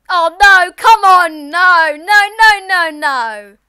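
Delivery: loud, high cry of protest; dismayed shout. Real, not acted. Dismayed reaction during the game.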